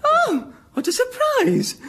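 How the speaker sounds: high, slow hammed-up delight; mock surprise. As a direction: oversell the surprise. Mock surprise, much higher.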